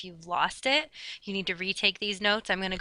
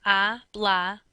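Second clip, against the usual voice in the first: "a, bla" in two separate, louder beats; counting out syllables; 5 dB louder than usual. Shown as a demonstration. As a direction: say "a", then "bla", each syllable set apart. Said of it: "So I count out my syllables".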